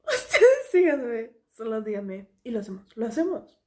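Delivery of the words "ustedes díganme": loud, drawn-out, high and laughing; laughing insistence. Real, not acted. Vlogger urging viewers to decide on a second part, laughing insistence. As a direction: stretch it out loudly through a laugh.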